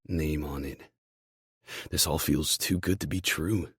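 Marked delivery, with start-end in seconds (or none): none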